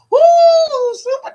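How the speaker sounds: real delighted whoop; joyful holler. Real, not acted. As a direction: let out a big woo.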